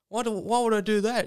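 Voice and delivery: high-pitched puzzled question; mock bafflement, high voice; Danny Boy. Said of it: "He's like".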